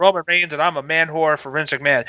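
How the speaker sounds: fast, low mocking impression of Roman. Imitating Roman's promo to the crowd, mocking. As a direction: sneer it out as a smug wrestler. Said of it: "where Roman said".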